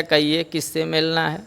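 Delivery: ordinary talk, nothing marked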